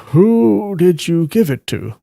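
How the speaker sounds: lightly voiced inquiring elder; questioning master. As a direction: press gently for an answer. Master questions her about the drawing.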